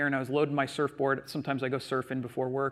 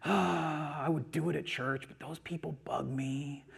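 soft, slow complaining with weary sighs; grumbling excuses; 3 semitones lower, 7 dB quieter, slower than usual. Retold or imitated. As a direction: mimic a reluctant, put-upon churchgoer. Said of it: "we get into these moods".

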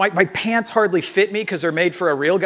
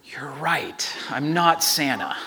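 low, whispery admission; hushed, careful owning up; quiet confession; 3 semitones lower, 6 dB quieter, slower than usual. Retold or imitated. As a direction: let it out slowly and softly. Retold confession to quieted child.

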